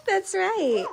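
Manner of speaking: high delighted laugh, then praise through it. Real, not acted.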